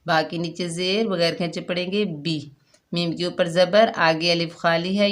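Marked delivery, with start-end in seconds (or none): none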